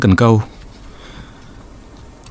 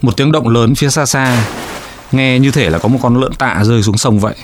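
ordinary delivery

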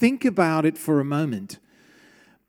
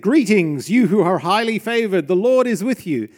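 loud proclamation of the angel's greeting; 4 semitones higher, 7 dB louder than usual. Retold or imitated. Preacher voices the angel greeting Mary, loud and proclaiming. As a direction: announce it like a herald angel, full voice. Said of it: "the angel went to her and said".

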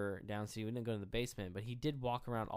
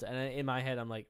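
ordinary talk, nothing marked

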